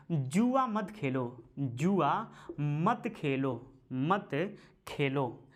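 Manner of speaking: slow, leaning on मत; slow, emphatic teacher dictation. Shown as a demonstration. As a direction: stretch it out so they can write it. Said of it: "dictating the fourth sukta".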